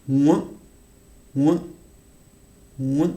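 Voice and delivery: "moins" with its nasal vowel, said three times; nasal vowel demo. Shown as a demonstration. Teacher showing students the nasal sound in "moins". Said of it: "On a une sonorité en français".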